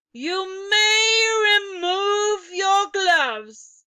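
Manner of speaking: very high, loud, slow witch shriek; Grand High Witch shrieks at the witches; shrill shouted command. Acted. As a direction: stretch the order out as a screech. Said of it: "she shouted".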